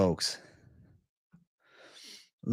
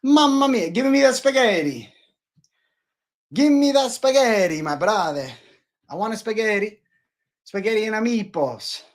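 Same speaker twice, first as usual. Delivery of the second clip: exaggerated Italian accent, pitched way up; mock Italian accent; 9 semitones higher than usual. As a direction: play a cartoon Italian raving about spaghetti. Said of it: "Captain Italian".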